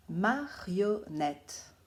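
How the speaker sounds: Marionnette said very slowly, syllable by syllable; slow, clear dictation to children. Shown as a demonstration. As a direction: spell out each syllable of Marionnette for the pupils. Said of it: "il faudra bien les écouter".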